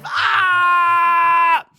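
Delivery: loud, high pained scream; sudden high shriek of defeat; anguished scream. Real, not acted. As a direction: let out a real cry of despair. Deals into a hand.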